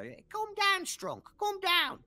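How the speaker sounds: very high goblin soothing a mate; calming goblin. Acted. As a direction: settle him down in a squeaky voice. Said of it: "you hear another one go".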